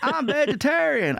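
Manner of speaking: high, drawn-out friend imitation; Ralphie May. Friend confesses over steakhouse plan, slow high-pitched mock confession. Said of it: "And he goes".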